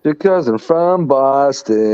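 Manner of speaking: slow, drawn-out Boston accent try; attempted Boston accent. Shown as a demonstration. Repeating the friend's Boston slogan, slow Boston accent. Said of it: "Yeah, close".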